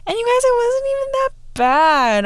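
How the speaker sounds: high, loud, gushing "you guys"; squealy excited aside; excited exclaim. Real, not acted. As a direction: burst out to your viewers in disbelief. Exclaims to viewers, surprised.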